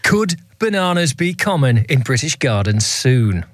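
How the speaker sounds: slow, measured newsreader headline; deliberate mock-serious news read. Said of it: "do this one in my newsreader voice".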